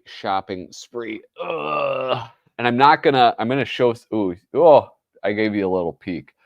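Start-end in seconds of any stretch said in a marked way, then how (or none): none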